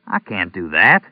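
ordinary delivery, nothing marked

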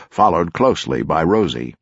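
ordinary delivery

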